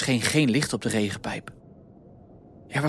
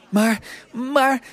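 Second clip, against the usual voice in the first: high-pitched attempted objection; sputtering protest; 12 semitones higher, 4 dB louder than usual. A narrator's reading. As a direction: try to get a protest in, high. Koekenbakkerspiet probeert tegen te sputteren. (hoog, tegensputterend)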